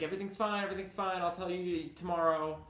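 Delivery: high and breezy, brushing her worry off; retold reassurance. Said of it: "I was like".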